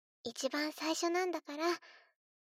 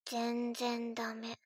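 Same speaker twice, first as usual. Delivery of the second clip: slow, low and softer, coldly dismissive; cold disappointment; 4 semitones lower, 4 dB quieter, much slower than usual. Acted. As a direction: let the verdict drop like ice. Cold verdict on the dance.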